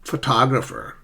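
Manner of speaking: "photographer" with TOG stressed; stress on second syllable. Shown as a demonstration. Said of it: "Compare that with".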